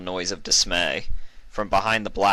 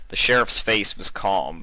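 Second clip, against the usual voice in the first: plain reading voice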